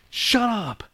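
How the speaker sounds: high, sharp shut up; mock scolding; mock annoyed. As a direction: imitate an irritated viewer. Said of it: "You're like".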